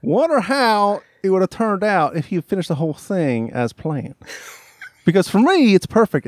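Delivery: high rural Virginia twang; Winchester, Virginia accent. Acted. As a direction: keep the put-on Virginia country accent going. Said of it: "Winchester, Virginia English".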